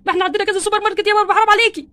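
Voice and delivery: yelling at his wife over the cost; exasperated shout. Acted. Ken furious at the bill. (صراخ غاضب من الزوج على طلبات زوجته)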